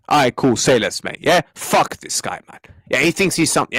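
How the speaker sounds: normal talking voice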